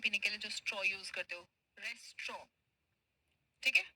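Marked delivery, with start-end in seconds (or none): 1.8-2.4 "रेस्ट्रॉ" softly, ending exactly like "straw"; rhymes with straw. Shown as a demonstration. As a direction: say "रेस्ट्रॉ" as res plus straw. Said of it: "स्ट्रॉ बोलते हैं ना हम". ("रेस्ट्रॉ" में स्ट्रॉ की आवाज़)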